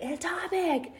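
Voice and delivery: retold yelling voice. Inner voice waking her at night, mock yell. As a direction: imitate an urgent inner voice calling out. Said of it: "this voice was just yelling me".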